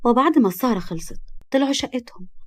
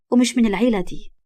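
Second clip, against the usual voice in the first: plain reading voice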